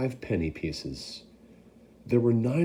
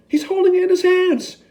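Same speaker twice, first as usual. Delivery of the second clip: much higher, louder excited call; shouting; 8 dB louder than usual. Acted. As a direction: shout to the crowd. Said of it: "someone else shouted".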